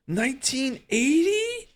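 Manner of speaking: slow, very high-pitched disbelief; stunned squeaky question; incredulous. Real, not acted. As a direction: draw it out high, utterly astonished. Cannot believe the revealed year.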